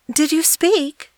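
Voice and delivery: put-on high, amazed little girl; wondering. A narrator's reading. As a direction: ask it in pure wonder. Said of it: "asked the girl in wonder".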